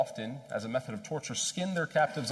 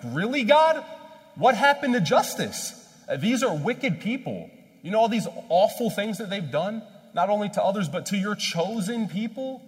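high-pitched, indignant protest, loud at first then easing; Jonah arguing; 9 semitones higher, 7 dB louder than usual. Acted. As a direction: argue with God in outraged disbelief. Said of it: "I can imagine him arguing".

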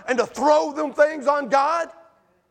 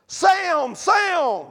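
higher, louder calling out "Sam"; shouting; 5 semitones higher, 3 dB louder than usual. Acted. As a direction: call out the window to the neighbor. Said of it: "and shouted".

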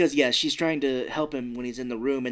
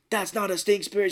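surprised; 8 semitones higher, 4 dB louder than usual. Retold or imitated. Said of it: "Yubaba's kinda like".

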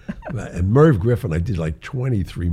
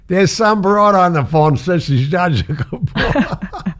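higher, louder and slow, tough street voice; street-lady mother; 12 semitones higher, 7 dB louder, slower than usual. Retold or imitated. Mother announcing the call to her son, hammed-up street voice. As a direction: ham up a blunt street mom shouting across the room. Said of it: "a street lady".

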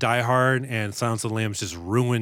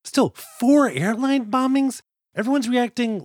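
slow, much higher disbelief; incredulous; 10 semitones higher than usual. Real, not acted. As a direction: stretch it out in outrage. Incredulous at casual reaction.

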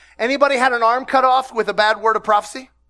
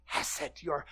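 whisper; 8 semitones lower, 16 dB quieter than usual. Shown as a demonstration. Pastor whispers Hebrew word.

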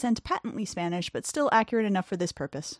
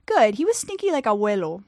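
put-on little girl voice; 4 dB louder than usual. A narrator's reading.

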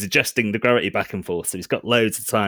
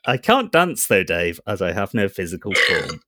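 calm computer voice; robot voice; 2 semitones higher than usual. Acted. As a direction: speak like a ship AI. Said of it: "My robot voice?".

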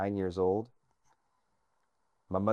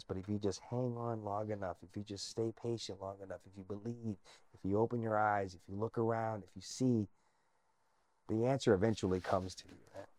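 soft, earnest encouragement; sincere; 7 dB quieter than usual. Real, not acted. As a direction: speak it gently, from the heart. Heartfelt life lesson.

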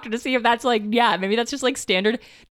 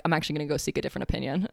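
fast, lower, softer aside; quick quiet mock patient; 7 semitones lower, 8 dB quieter, much faster than usual. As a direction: rattle it off under your breath.

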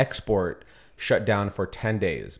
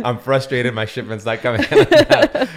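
mock frustration; 7 dB louder than usual. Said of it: "Then that's your".

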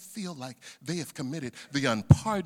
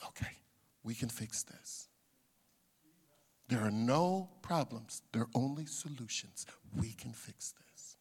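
low, soft, unhurried comfort; calm reassurance; 8 semitones lower, 7 dB quieter than usual. Retold or imitated. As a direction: reassure a troubled person, almost whispering. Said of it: "what I show".

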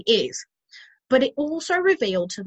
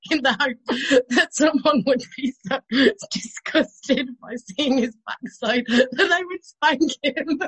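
words breaking up with laughter; real helpless giggling; 4 semitones higher than usual. Real, not acted. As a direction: struggle to finish the sentence while laughing. Cracking up at her own story.

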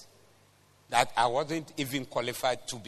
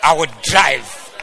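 slower bold shout; 10 dB louder, slower than usual. Pretend. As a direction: shout "I will drive" slowly, stretching each word. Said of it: "God has given me a car".

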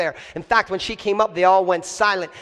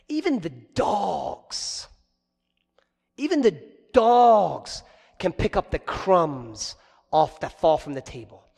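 humble plea; 3 semitones lower, slower than usual. Acted. Woman's plea, voiced softly.

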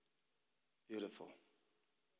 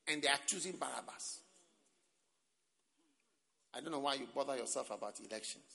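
ordinary delivery

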